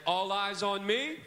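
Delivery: loud, slow, rising call for attention. Shown as a demonstration. Teacher calling the kids to look at him. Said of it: "I may say something like this".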